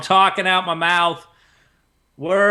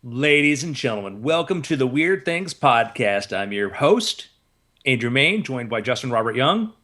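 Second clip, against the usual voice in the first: lower, measured host intro; podcast host intro; 6 semitones lower than usual. Acted. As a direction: welcome the audience like a host. Show intro.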